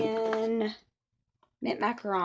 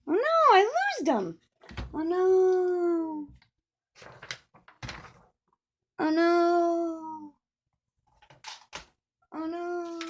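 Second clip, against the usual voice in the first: high, hammed-up oh no; playful despair over lost snips; mock panic; 7 semitones higher, much slower than usual. Can't find her snips.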